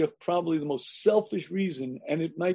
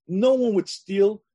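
ordinary delivery

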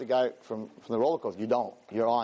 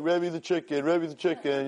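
imitating teasing kids, pitched up; higher, sing-song playground teasing; mock taunting; 4 semitones higher than usual. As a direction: chant it like schoolkids goading someone. Students tease their rabbi.

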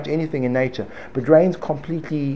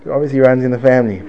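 ordinary talk, nothing marked

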